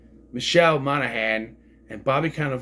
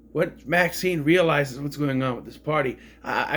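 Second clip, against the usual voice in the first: ordinary delivery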